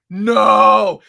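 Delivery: loud, startled no; shocked cry. Retold or imitated. Watching the show with his wife, a loud shocked no. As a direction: blurt it out like a fan in shock. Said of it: "and you're like".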